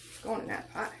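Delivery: breathy whisper, no voice, very soft; whispered aside. Real, not acted. Cook murmuring to herself as seasoning goes in, whispered. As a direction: let the repeat drop to a secret whisper. Cook repeats, hushed, to pot.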